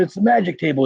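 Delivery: put-on British accent. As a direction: mimic the British guy from the video. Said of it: "he's got a British accent".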